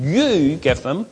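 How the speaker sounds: "You" drawn out with a swooping pitch; slow down and lean on "You"; emphatic, lengthened "You"; stress on You. Acted. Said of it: "It's stressed".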